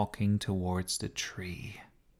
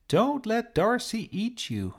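high pleading call to the bird; shouted warning; 13 semitones higher than usual. Acted. Sophie calling to the sparrow about the cat, high urgent shout. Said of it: "she shouted".

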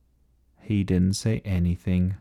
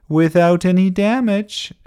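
high, loud gleeful call; crying out; 10 semitones higher, 6 dB louder than usual. A narrator's reading. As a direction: cry it out in triumph. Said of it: "cried Ernest".

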